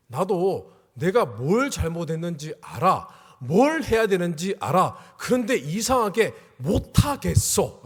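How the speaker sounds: slow, emphatic, increasingly loud admission; a child's plea, spelled out slowly; drawn-out words with heavy emphasis; retold confession.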